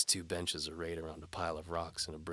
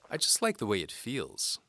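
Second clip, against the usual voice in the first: higher, louder teasing lady voice; seductive noblewoman; 6 semitones higher, 8 dB louder than usual. A narrator's reading. Antimony dares the knight to follow. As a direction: tease the knight like a bold noblewoman.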